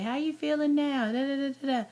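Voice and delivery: high chirpy nurse voice; mock the nurse's cheery check-in; imitating nurse.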